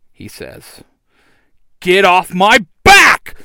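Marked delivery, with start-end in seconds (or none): 1.8-3.4 high, slow, very loud shout; forceful yell. A narrator's reading. Red Ranger under a pile of fighters, forceful drawn-out yell. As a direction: bellow it with full force. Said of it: "the Red Ranger yells".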